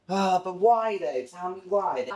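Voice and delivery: high pleading whine at the tub; exasperated whine. Real, not acted. Clumped pre-workout frustration.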